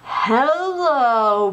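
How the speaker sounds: ordinary delivery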